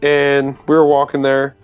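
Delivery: ordinary delivery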